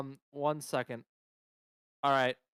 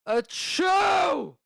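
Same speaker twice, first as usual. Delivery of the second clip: sudden loud high sneeze; 5 dB louder than usual. Real, not acted. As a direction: let out a big sneeze. Sneezes with a cold.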